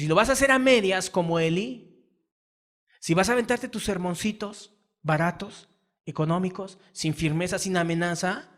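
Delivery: sermón fuerte y enfático; predicación a toda voz. Acted. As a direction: truena desde el púlpito. Predica con fuerza. (predicador regañando a los padres, voz fuerte)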